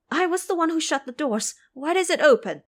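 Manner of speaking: stunned it's open; boy finding the garden doors open; shocked boy. Acted. Qing Feng shocked at open doors.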